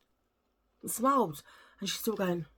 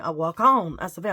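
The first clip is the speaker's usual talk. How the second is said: ordinary talk, nothing marked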